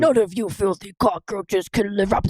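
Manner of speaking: hammed-up anime villain; villain's tirade in a put-on voice; acted villain rant. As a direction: sneer it as a cartoon villain. Acts villain's rant, cut off.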